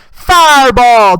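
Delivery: high, booming drawn-out mock holler; imitated yell. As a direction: shout it like the song's hook. Re-enacts the girl's yell.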